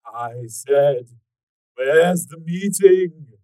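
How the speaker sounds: loud, slow, drawn-out demand; menacing demand. Acted. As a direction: bellow it slowly at a helpless captive. Repeats demand while torturing.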